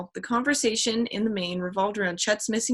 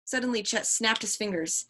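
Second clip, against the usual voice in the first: ordinary delivery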